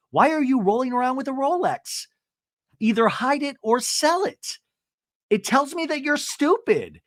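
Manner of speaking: hammed-up lecture; mock scolding Emily. Podcaster imitates Emily calling Jen stupid. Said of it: "Emily goes".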